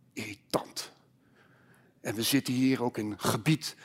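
low, soft sermon storytelling with long pauses; hushed preaching. Acted. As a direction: let the silence hang between quiet phrases. Preacher dwells on the silence.

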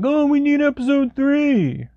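high, slow whiny demand; whiny fan voice. Retold or imitated. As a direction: mimic impatient fans begging. Mimics impatient listeners demanding episodes.

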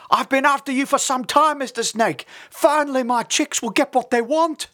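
high, loud predator bird voice; big bird calling out high and forceful; colossal osprey. A narrator's reading. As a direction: crow loudly over the captured prey. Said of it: "said the voice of a colossal osprey".

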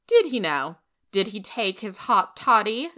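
loud, high, mocking question; sarcastic demand. Rachel mocking Marilla's tonic, loud and sarcastic. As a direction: needle her with sarcasm. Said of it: "demanded Missus Lynde with sarcasm".

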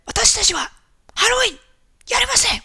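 loud, high-pitched imitation of mom yelling; scolding shout, phrase by phrase. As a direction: yell it at the kids in broken Japanese. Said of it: "yelled at them in Japanese".

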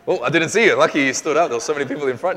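loud, high surprised call. Real, not acted. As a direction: call out across the room, pleased. Spotting a questioner in crowd.

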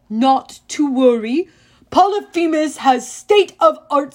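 loud, slow broken-English giant; a gloating brute showing off his prize; booming Cyclops. A narrator's reading. Cyclops bragging to Grover, loud and slow.

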